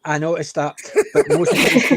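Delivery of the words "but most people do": high, loud laughing words. Real, not acted. Laughs at the accent remark.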